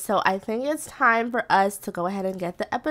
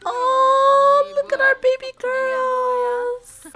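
very high, slow coo; adoring coo; 12 semitones higher, much slower than usual. Real, not acted. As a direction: draw it out high over the babies. Cooing at the newborns.

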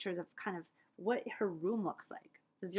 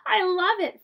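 a burst of loud, high glee; hooting delight; 9 semitones higher, 11 dB louder, slower than usual. Acted. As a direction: hoot it out happily. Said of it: "Fred hooted".

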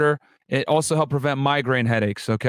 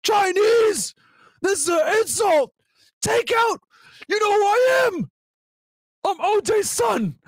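much higher, hammed-up furious chef; mock enraged Jason; slower than usual. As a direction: play the insulted son, yelling over takeout. Voicing Jason's rage again.

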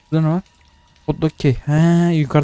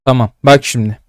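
pumped up; 7 dB louder than usual. Real, not acted. Psyching himself up.

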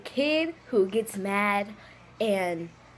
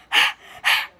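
short, airy mock sob bursts; loud fake sobs; 5 dB louder than usual. Shown as a demonstration. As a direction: huff out two pretend sobs. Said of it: "are very loud".

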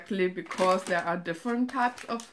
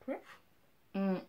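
chewing while talking; 3 semitones lower, 4 dB quieter than usual. Real, not acted. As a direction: mouth partly full. Seen 1: Bites chocolate just before; hand covers mouth while speaking after.

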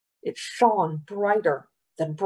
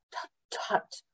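soft tongue-click tutting; chiding; 11 dB quieter than usual. A narrator's reading. Governor stops boy's flattery, chiding. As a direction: gently shush with disapproving tuts. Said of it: "the governor chided".

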